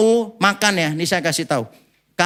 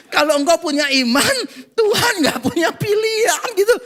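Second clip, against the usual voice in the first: incredulous; 7 semitones higher than usual. Real, not acted. Incredulous retelling.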